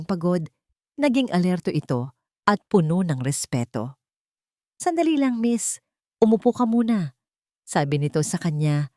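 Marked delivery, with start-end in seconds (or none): 4.8-7.1 higher-pitched, deferential officer; suddenly courteous, pitched up; newly respectful. A narrator's reading. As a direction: politely ask her to wait and sit. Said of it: "Ang tono ay ibang-iba na".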